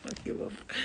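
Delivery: breathy whispered shrug; whispered defiance. Retold or imitated. Answering friends at dawn in a defiant whisper. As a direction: toss it off under her breath. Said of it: "I'm like".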